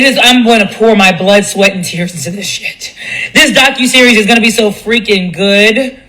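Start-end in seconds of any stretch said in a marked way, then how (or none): none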